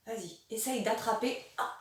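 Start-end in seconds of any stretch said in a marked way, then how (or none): none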